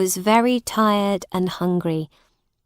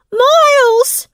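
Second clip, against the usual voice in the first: very high, loud calling out; shout across a crowd; 8 dB louder than usual. Acted. Boy calling his friend over the crowd, loud shout. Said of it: "he shouted across the people".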